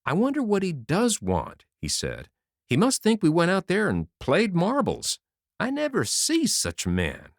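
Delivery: high, resentful grumbling; aggrieved complaint. A narrator's reading. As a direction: let the grievance show in every word. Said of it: "with a grievance in his eye".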